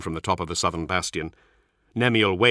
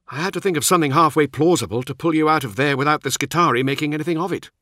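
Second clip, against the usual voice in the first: higher-pitched squad commander's voice; brief the men in a raised character voice; narrator's higher voice for an officer; Nemiel; 8 semitones higher, 4 dB louder, faster than usual. Acted.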